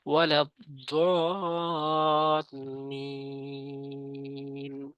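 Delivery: slow, drawn-out chanted recitation; Quran recitation. Shown as a demonstration. Teacher recites the verse for viewers, slow and chanted. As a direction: stretch each long vowel like tajweed. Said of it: "চাল একটু টেনে পড়বেন".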